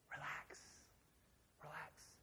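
near-whispered, very soft calming words; imitated hushed soothing. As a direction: imitate a wife gently hushing her husband. Imitating wife soothing him.

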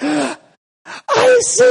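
gasping, very high mock child cry; little boy's wail. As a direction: suck in air, then squeal it like a toddler. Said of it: "He's like".

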